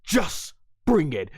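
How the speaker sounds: slow taunting wrestler voice; drawn-out challenge with a pause before the end; the Rock. Retold or imitated. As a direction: imitate a cocky final boss. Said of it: "he's the Rock now".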